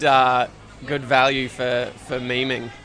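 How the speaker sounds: ordinary talk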